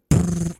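loud, high lip-trilled gunfire; imitated machine-gun burst. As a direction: rattle off a mock machine-gun blast. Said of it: "like a machine gun".

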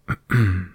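small preparatory throat-clear; little cough. A narrator's reading. Pooh readies himself to recite his poem, little cough. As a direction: clear your throat lightly before reciting. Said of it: "Pooh gave a little cough and began".

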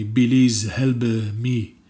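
slow "Belize help me", B in place of P. Shown as a demonstration. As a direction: drag out "Belize" with a B sound. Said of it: "Everything's with a B".

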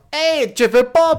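high, loud, drawn-out stage call; loud stage outburst. Acted. Comic to a crowd that isn't laughing, loud outburst. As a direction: belt it out to a dead room. Acting comedian facing silent crowd.

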